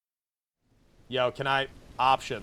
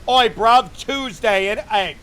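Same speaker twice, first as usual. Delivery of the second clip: put-on higher, louder London street accent; mock Cockney; 6 semitones higher, 8 dB louder, slower than usual. Streamer blurting at the game, mock London accent. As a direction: slip into a cheeky London geezer. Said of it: "That was involuntary".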